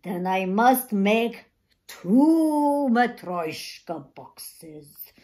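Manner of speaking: put-on old man grudgingly drawing it out; slowly. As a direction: stretch each word out deliberately. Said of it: "said Boxer slowly".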